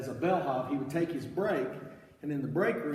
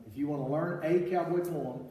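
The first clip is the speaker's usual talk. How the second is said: ordinary talk, nothing marked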